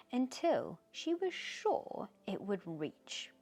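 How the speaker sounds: ordinary delivery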